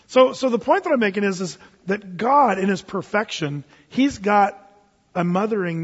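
usual speaking voice

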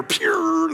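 squeaky zip noise of people bolting; high "pew" whoosh. Retold or imitated. Preacher showing the disciples fleeing with a high whoosh. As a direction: make a quick high whoosh.

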